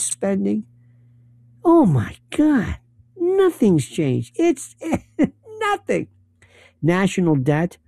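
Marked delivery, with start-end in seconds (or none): 1.6-6.1 exasperated laugh. Real, not acted. Exasperated over military spending.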